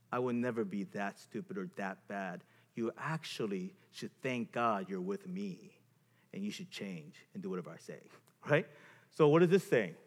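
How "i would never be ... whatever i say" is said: low, quiet moral superiority. Acted. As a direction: look down on them, voice lowered. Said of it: "a stance of a moral superiority".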